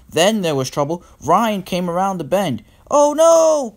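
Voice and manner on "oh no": loud, very high cry of alarm; high storybook wail of dismay. A narrator's reading. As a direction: cry out at a near crash. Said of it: "cried Ryan".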